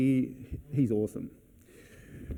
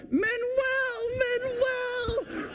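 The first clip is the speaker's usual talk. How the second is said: very high, drawn-out squeal of a name; pumped, excited calling; much slower than usual. Retold or imitated. Guests spotting the doorman at last, high excited calling. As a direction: re-enact a thrilled falsetto cheer. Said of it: "Like, so pumped".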